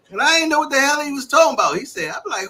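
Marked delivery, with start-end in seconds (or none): none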